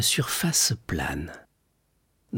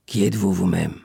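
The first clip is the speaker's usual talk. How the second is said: put-on lower, muffled old prisoner; sepulchral voice; 4 semitones lower than usual. A narrator's reading. Old prisoner answering through the wall, low voice. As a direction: answer from under the earth. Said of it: "un accent sépulcral".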